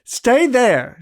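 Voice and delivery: sharp, loud, high command. A narrator's reading. Woman orders her suitor to stay back, loud sharp command. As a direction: order him to stay put, loudly. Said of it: "she commanded".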